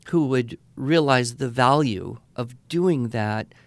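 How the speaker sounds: usual speaking voice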